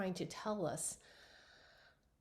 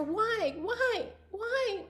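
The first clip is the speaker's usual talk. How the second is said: higher, rising cries of why; anguished pleading; 12 semitones higher, much slower than usual. Retold or imitated. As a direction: beg for an answer in grief. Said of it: "I asked her".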